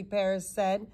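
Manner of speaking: ordinary delivery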